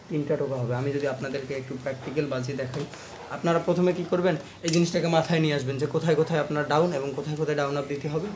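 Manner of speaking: normal talking voice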